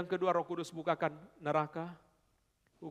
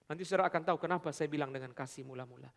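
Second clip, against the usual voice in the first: usual speaking voice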